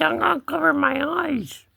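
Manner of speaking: high-pitched kitty talk; cat voice. Pretend. Cat hiding from his owner, high cat voice. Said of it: "He said".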